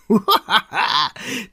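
high braying laugh; loud mocking laugh. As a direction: bray at him. Villámököl laughs. (harsány röhögés)

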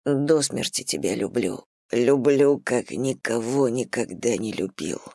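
low, hushed man's voice; Igor's inner confession. A narrator's reading. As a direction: breathe it out low as a secret vow. Игорь мысленно признаётся в любви. (низкий тихий голос Игоря про себя)

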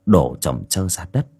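plain reading voice

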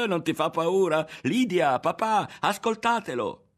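high, loud mockery with a laugh, climbing to a yell; shrieking, laughing taunt; gleeful jeering. A narrator's reading. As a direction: crow and call everyone to listen. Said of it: "saltava agitando le braccia".

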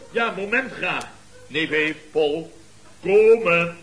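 slow call across the room, rising to a loud call; drawn-out call that swells loud at the end. Acted. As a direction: summon the nephew from afar. Uncle summoning nephew.

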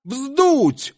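hoot. Acted. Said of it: "ухнул кто-то во мгле".

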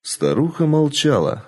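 usual speaking voice

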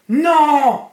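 very high, loud drawn-out scream; howl. A narrator's reading. Dark man faces the ball of fire, howling. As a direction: howl in refusal at full voice. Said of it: "hurla l'homme noir".

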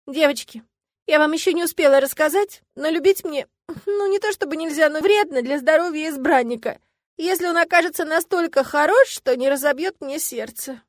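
plaintive confession. A narrator's reading. Heroine reluctantly explains her curse.

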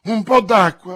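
very loud, high-pitched call; desperate cry for water; urgent shout. A narrator's reading. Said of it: "gridava D'Artagnan".

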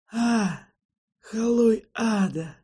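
slow, strained words through pain. Acted. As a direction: force it out while hurting. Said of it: "еле выговорил Седрик сквозь боль".

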